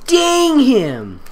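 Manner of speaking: high, dramatic "Dang him!" with a laugh; mock outrage. Shown as a demonstration. Reader hamming the girl's curse at the kidnapper. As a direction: overplay the curse.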